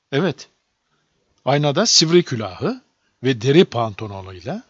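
ordinary delivery, nothing marked

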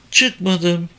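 put-on high girl's voice; young girl. A narrator's reading. Girl answers police questioning, high young voice.

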